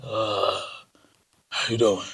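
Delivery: deep croaky Beetlejuice growl; low creepy voice. Shown as a demonstration. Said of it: "cute demeanor voice".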